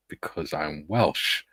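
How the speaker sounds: slow, low and soft deadpan; slow proud answer. Retold or imitated. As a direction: drawl it out with pride. His proud reply in the story.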